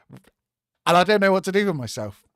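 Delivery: can't contain the excitement; overjoyed. Real, not acted. Fan overjoyed by signing.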